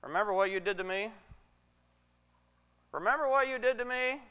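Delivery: high, quick playground teasing; mock gloating taunt. Imagined Joseph getting back at his brothers, gloating playfully. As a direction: rub it in with a rising, childish taunt. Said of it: "perfect opportunity to go, Neener, neener".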